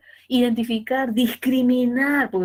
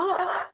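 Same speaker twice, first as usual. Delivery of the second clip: put-on baby babble; 9 semitones higher than usual. Imita balbuceo de la bebé.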